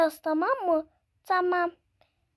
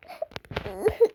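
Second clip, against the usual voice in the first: a low, mock crying wail; pretend sob; 8 semitones lower than usual. Daughter getting her injection, with a mock crying wail. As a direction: wail like the child getting a shot.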